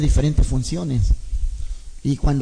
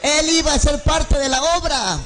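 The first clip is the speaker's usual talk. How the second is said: preaching at full force; 8 semitones higher than usual. Acted. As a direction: preach it out, rising and ringing. Predica a toda voz. (predicación encendida, a toda voz y cantada)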